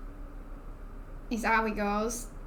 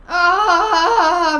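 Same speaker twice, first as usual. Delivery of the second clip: loud exasperated laughing cry; a loud high laughing wail; frustrated laughing groan; 6 semitones higher, 12 dB louder than usual. Real, not acted. Frustrated laugh, can't recall.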